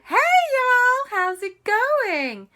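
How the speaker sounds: very high, loud chirpy greeting. Acted. Storyteller voicing JB greeting the kids, very high and loud. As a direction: squeal it out as bubbly JB.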